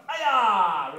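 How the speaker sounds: loud, high martial-arts kiai; demonstrated yell. Shown as a demonstration. Coach showing a child how to yell really loud, full-force yell. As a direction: belt out a long karate yell. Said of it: "you can yell really loud".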